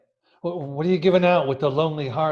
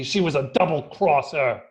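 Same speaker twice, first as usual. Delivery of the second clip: ordinary talk, nothing marked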